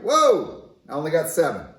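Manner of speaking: mock alarm at coming up short; cartoon atom yelping in surprise; startled nitrogen. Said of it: "Nitrogen is like".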